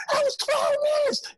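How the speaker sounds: very high exasperated shout; exasperated yell. Retold or imitated. Road manager correcting comic, exasperated high shout. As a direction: fling the correction back.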